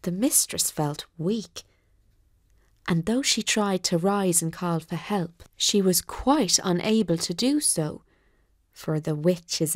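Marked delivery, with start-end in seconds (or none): none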